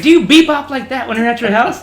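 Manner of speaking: very loud, high mock reprimand; hard chief's yell. Chief to recruit who can't march, mock angry yell. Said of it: "our chief was trying to be hard on him".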